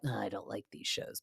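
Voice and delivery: imitated husband aside. Imitating husband's dismissive remark.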